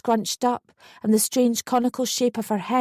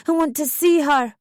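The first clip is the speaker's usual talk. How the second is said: higher, louder urgent cut-in; urgent jump-in; 5 semitones higher, 3 dB louder than usual. A narrator's reading. Mother cutting in to see her baby, urgent. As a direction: jump in eagerly. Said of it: "I jumped in".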